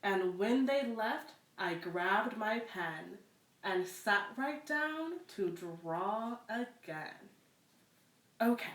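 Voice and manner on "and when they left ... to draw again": put-on reading aloud to children, unhurried; story narration. A narrator's reading. Librarian reads a picture book to children, storytelling voice. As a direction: tell the tale gently to little listeners.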